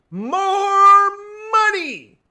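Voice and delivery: very high, very loud hammed-up shout. Escalated repeat, shouting.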